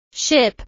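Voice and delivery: SHIP with a short, lax i; short vowel. Shown as a demonstration. Teacher drilling learners on vowel sounds, short i in Ship. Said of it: "is a short sound".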